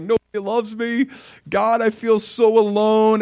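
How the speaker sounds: high, tearful mock lament; imitating crying prayer. Young man recalling lonely prayer, high tearful plea. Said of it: "I was just crying before the Lord".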